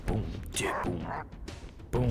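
normal talking voice

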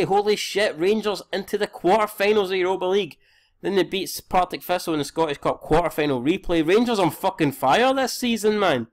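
high excited gushing. Real, not acted. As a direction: cheer the team's run with disbelief. Rangers reach quarter-finals.

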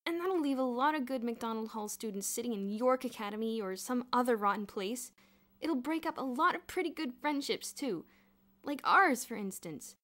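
heated, emphatic complaint; upset outburst. A narrator's reading. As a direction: rail bitterly at how unfair it is. Bruno's upset outburst after growled retort.

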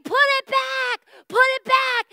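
high repeated shout while chasing; shouting put it back. Retold or imitated. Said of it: "And I kept saying".

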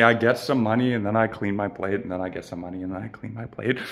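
soft, fading repetition; quiet rhythmic. Real, not acted. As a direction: sink into a calm refrain. Winding down, repetitive.

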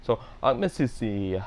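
Messi with the first I stressed then dropped, said slowly; slow careful Ang Messi. Shown as a demonstration. Learner practising Ang Messi slowly. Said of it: "first bit of the I has some emphasis".

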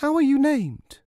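high, eager question; mother. A narrator's reading. Mother asking her new son's name, high-pitched. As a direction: ask it in a high motherly character voice.

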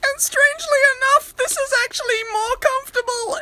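very high squeaky falsetto. Shown as a demonstration. Showing friends the only other voice, squeaky falsetto. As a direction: jump way up into a tiny high voice. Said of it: "I can only talk like this, or like this".

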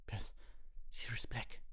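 put-on high, whispery weak boy; faint. A narrator's reading. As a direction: say it weakly, almost whispering. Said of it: "sagte Ron matt".

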